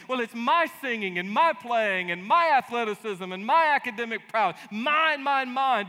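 high, loud, mock mine mine; whiny possessive child. Believers claiming their gifts, high loud whiny mock. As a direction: whine it like a greedy toddler. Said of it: "we say".

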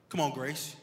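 ordinary delivery

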